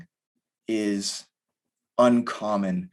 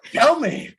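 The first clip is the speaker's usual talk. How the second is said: put-on very high and louder, an impatient kid's plea; eager kid begging; 7 dB louder than usual. An eight-year-old son begging his fireman dad for the story, excited. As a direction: squeal it like a kid who can't wait. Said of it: "he's just stoked".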